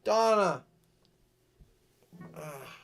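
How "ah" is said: usual speaking voice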